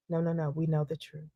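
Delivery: soft, hushed, gently knowing; a quiet calm interviewer voice. Retold or imitated. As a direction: calmly reassure her you both know. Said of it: "It was a calm kind of thing".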